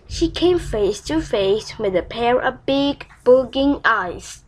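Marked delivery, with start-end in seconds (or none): none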